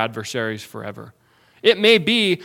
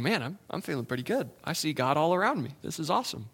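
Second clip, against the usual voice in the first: carefree believer; 9 dB quieter, faster than usual. Retold or imitated. Pastor imitating a comfortable believer, light quick quote. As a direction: rattle off a self-satisfied thought. Voicing content believer's thoughts.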